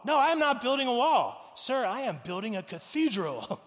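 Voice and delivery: loud, high, grandiose correction; hammed-up proud craftsman, starting at full force; proud indignation. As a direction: declare it with theatrical gusto. Worker proudly corrects, building with gusto.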